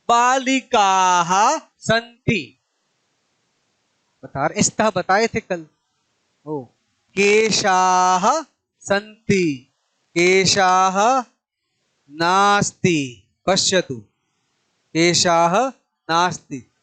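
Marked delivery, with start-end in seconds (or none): 0.1-2.6 बालिकाः with its final आः held long and slow; stretched ending. Shown as a demonstration. Teacher drilling the class, slow stretched बालिकाः. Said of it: "आखिरी शब्द को विशेष जोर दें।". 7.1-13.3 केशाः said slowly with the final आः stretched; slow drill. Shown as a demonstration. Teacher chanting examples slowly for the class. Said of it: "आखिरी शब्द को विशेष जोर दें।".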